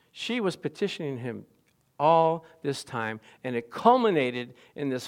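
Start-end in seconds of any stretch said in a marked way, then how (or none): none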